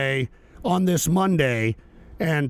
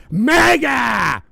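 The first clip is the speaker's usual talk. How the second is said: mock rally shout; 5 semitones higher, 7 dB louder than usual.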